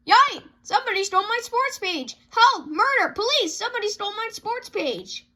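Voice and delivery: high, loud yells of outrage; hammed-up alarm over a stolen sports page; shrill panic. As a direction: yell for help like the sky is falling. Papa finds his paper stolen.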